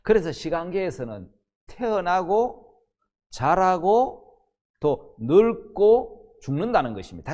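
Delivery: ordinary talk, nothing marked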